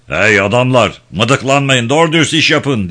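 loud foreman's call, pitch climbing; shouted warning. A narrator's reading. Kosor warns the men working beside Cemal, loudly scolding. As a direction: bark orders at a slacking crew. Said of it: "diye ikaz etti Kosor".

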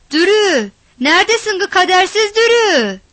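high, loud calling out across the yard; shouting. A narrator's reading. Havana shouting for Dürü around the house. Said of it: "diye bağırdı".

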